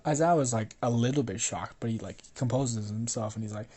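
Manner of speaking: usual speaking voice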